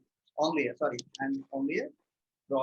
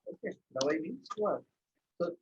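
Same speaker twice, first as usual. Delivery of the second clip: ordinary talk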